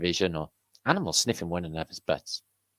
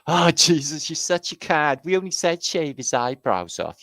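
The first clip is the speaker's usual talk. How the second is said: high, loud camp scolding; 10 semitones higher, 8 dB louder than usual. Acted. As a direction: tut at him, camp and shrill.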